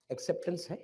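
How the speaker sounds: ordinary talk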